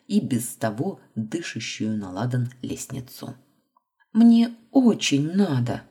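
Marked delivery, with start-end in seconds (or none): 4.1-5.8 urgent, slow, loud plea; pleading insistence. A narrator's reading. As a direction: make them believe you need it. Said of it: "вложить как можно больше убедительности в голос".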